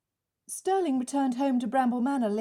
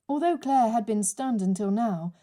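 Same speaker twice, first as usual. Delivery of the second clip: ordinary delivery, nothing marked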